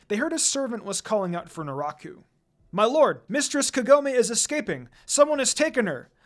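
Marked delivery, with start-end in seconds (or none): 2.7-6.1 higher, louder alarmed call; cried out loudly. A narrator's reading. Servant warning his lord of the escape, loud cry. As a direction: burst in raising the alarm. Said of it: "a servant cried out loudly".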